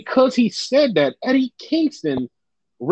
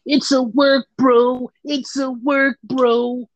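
higher-pitched mock insistence; mimicking the naysayers; 4 semitones higher, much slower than usual. Fans keep telling the host it's staged, mock-insistent. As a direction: mimic them, pitched up and drawn out. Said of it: "everybody literally just kept telling me".

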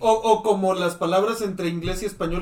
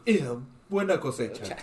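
pompous voice; 9 semitones lower, 6 dB quieter than usual. Retold or imitated. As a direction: play a self-satisfied snob. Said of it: "le hace con voz de mamador".

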